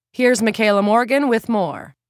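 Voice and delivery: upbeat anchor toss to reporter; bright newsroom handoff; news anchor handoff. Acted. Anchor hand-off.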